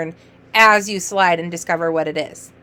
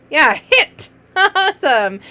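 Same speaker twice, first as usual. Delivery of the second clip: high, loud cheer with a laugh; excited confirmation; 6 semitones higher, 4 dB louder, slower than usual. Shown as a demonstration. Teacher cheering a reader's word, excited and laughing. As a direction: celebrate the answer, laughing.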